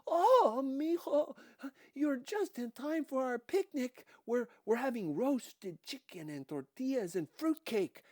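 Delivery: high, loud, overbearing motherly charm; loud gushing Dika. A narrator's reading. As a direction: smother the cop with hospitality. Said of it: "began talking loudly in Spanish".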